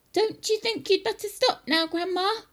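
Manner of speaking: put-on high boy's voice calling up; George's high-pitched call. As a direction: call up to Grandma on the roof. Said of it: "George called out".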